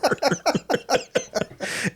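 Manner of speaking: laughing high and delighted; amused cackle after the quote. Real, not acted. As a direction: break into giddy laughter.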